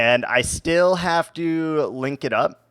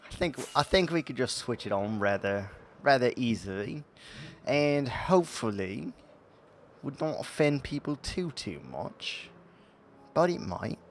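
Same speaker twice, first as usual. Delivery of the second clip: put-on British voice, softer; British accent; 6 dB quieter than usual. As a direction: play it as a mannered Brit. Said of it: "Must start talking in a British accent".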